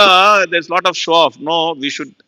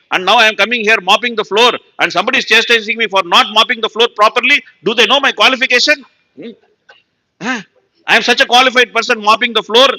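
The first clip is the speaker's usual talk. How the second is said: high-pitched mock indignation; wounded ego; 6 semitones higher, 3 dB louder than usual. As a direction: play the offended big man, voice raised high. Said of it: "my ego is hurting me".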